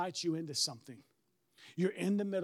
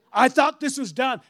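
the same line again, louder, with a laugh; louder exasperated repeat; 4 semitones higher, 11 dB louder than usual. Retold or imitated. Preacher reliving the dealer asking for more paperwork, louder laughing disbelief. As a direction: let the disbelief burst out.

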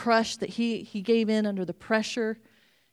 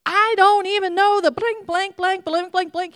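loud, much higher, furious swearing; cursing outburst; 10 semitones higher, 8 dB louder than usual. Acted. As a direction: blow up at them. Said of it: "He curses".

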